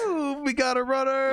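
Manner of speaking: slow, high mocking shout. Real, not acted. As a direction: call it out to the room. Caller hangs up.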